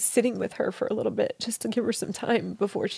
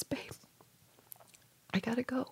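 much softer, breathed-out farewell; whispered goodbye; 13 dB quieter, faster than usual. Retold or imitated. Husband saying goodbye in her dream, whispered softly. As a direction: let it fall to a gentle whisper.

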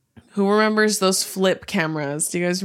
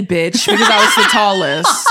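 laughing; 6 dB louder than usual. Real, not acted. Laughing teasing retort.

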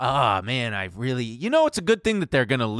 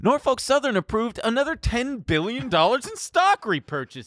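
high mock-surprised reveal; sarcastic high gasp at buybacks; mock excitement; 13 semitones higher than usual. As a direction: act shocked, pitched way up. Mock revelation, high put-on voice.

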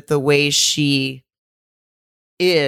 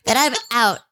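mock sing-song partner; 11 semitones higher than usual. Mocking a partner's skydiving ultimatum.

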